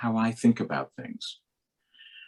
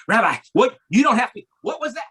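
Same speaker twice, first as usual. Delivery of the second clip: high, loud flustered cabbie; shaken driver; 12 semitones higher, 6 dB louder, faster than usual. Retold or imitated. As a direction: stammer out the question in shock.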